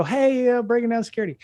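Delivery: high-pitched imitation of a fan's hail; excited fan calling out. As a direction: imitate a listener recognizing a podcast host. Voicing a fan who spots him.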